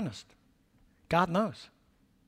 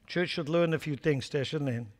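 ordinary talk

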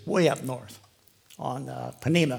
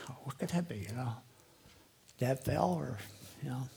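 whispered worry; 9 dB quieter than usual. Retold or imitated. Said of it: "I'm like".

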